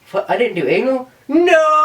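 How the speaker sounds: shocked cry that jumps high on No, laughing; mock horror. Dying woman at eighty-seven reads her bucket list, shrieking in mock horror. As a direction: pretend outrage at a missing bucket-list item. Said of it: "I'm like".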